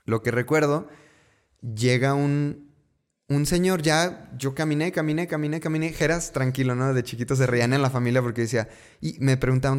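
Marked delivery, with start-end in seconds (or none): none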